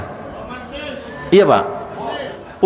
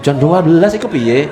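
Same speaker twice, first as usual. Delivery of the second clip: ordinary delivery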